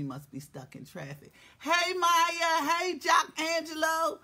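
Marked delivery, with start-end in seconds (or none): 1.6-4.2 loud high welcome; excited greeting. Real, not acted. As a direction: shout out your friends. Greeting viewers.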